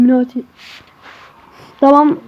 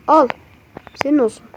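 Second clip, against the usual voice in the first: ordinary talk, nothing marked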